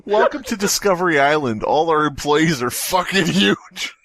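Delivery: normal talking voice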